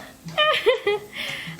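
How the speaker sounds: high-pitched giggle; a light, high laugh. Real, not acted. Laughs at the viewer's hunger.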